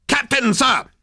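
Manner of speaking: high, loud military call; cried out. Acted. Soldier hailing his captain on return, loud. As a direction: snap to attention and sing out. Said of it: "cried number two".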